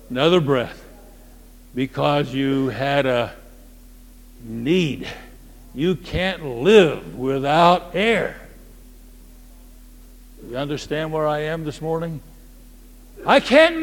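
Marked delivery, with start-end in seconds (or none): none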